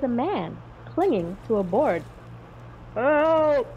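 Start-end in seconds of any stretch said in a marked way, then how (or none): 2.9-3.7 high, loud cry for help. A narrator's reading. Man in the sea hails the flyers, high cry for help. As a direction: call out urgently, high and loud. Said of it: "he cried".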